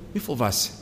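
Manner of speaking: softly spoken comfort; quiet reassurance. Acted. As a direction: drop to a soft, brief reassurance. Soft repeat after the loud proclamation.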